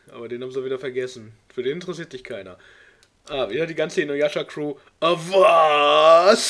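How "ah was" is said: loud, bewildered shout of confusion; baffled cry. Real, not acted. Reader startled by the fanfic text box, crying out in confusion. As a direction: blurt it out, completely thrown.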